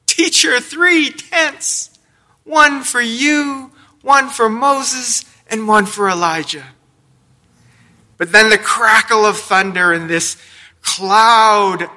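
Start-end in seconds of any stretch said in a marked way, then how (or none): 0.1-6.6 high, frantic blurting; excited shout. Retold or imitated. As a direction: shout it out in a panic. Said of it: "he shouts out".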